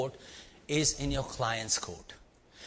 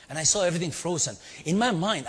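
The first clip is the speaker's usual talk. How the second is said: higher, louder and fast; excited buildup; 5 semitones higher, 4 dB louder, faster than usual. Acted. Telling the crowd about the freeze, excited and fast. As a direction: rush it as time freezes. Frozen moment of crisis.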